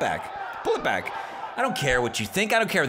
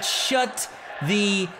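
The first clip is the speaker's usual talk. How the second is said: very slow, each word set apart; condescendingly drawn-out put-down; deliberately slow; 3 semitones lower, much slower than usual. Acted. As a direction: spell it out word by word with long pauses. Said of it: "I'm gonna say it slowly so you'll understand it".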